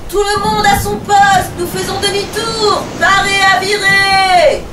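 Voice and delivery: high, loud commands, the last ones slow; captain ordering the crew to their posts; shouted orders. Acted. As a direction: call all hands over the wind.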